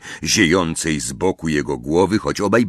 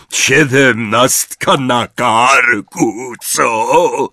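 higher, louder and slow giant's rumble; 5 semitones higher, 5 dB louder, much slower than usual. A narrator's reading. Hagrid to Harry at his party, booming. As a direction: boom it out like a big friendly giant. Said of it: "zagrzmiał Hagrid".

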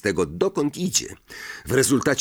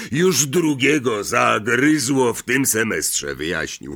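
Hagrid; 4 semitones higher, 4 dB louder than usual. A narrator's reading. Hagrid voiced.

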